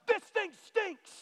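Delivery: high, slow, whiny moan; grumbling complaint. Retold or imitated. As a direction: whine it like a fed-up customer. Grumbling at broken gas pump.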